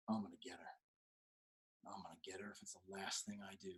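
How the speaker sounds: whispered revenge vow; whispered threat. Retold or imitated. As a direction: hiss the vow under your breath. Vowing revenge quietly.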